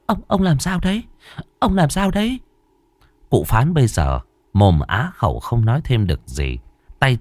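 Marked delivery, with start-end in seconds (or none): 0.1-2.4 high, frantic calling to her husband; panicked cry. Acted. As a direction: cry out in alarm to the old man. Wife cries out to fallen husband. (gọi hốt hoảng)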